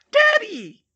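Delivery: very high, loud delighted squeal; a small child shrieking with joy; excited squeal. Acted. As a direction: toddler squealing at the sight of his dad. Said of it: "little James squealed".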